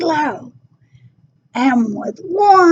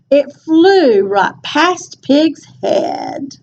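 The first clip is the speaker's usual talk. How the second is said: ordinary delivery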